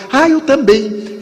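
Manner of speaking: thrilled to share the trip; delighted stranger. Retold or imitated. Stranger delighted same flight. (estranho contente, exclamação aguda)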